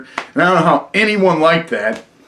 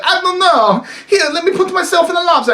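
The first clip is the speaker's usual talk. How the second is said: much higher, fast cartoon voice; a quick, pitched-up goofy Luigi; imitating Luigi; 12 semitones higher than usual. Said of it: "he's like".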